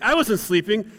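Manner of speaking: high, loud mock denial; defensive protest. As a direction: act out an indignant husband. Acted denial in quarrel.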